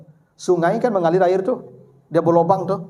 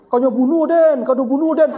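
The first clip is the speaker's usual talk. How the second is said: mock accusing cry; 6 semitones higher, 3 dB louder than usual. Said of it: "Cuma maki-maki".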